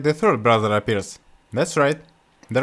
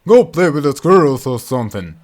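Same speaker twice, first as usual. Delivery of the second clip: put-on scolding old father; 8 dB louder than usual. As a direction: bark at your silly son. Father dismisses son.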